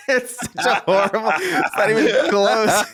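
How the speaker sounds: talking through laughter; laughing at a bad impression, mocking it. Real, not acted. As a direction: giggle out the words.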